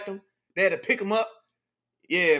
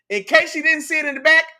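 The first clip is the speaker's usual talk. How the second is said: louder, higher, rising call to the back rows; 5 semitones higher, 6 dB louder than usual. Shown as a demonstration. Streamer calling out to viewers in the back, louder and higher. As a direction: call it out so the back of the room hears.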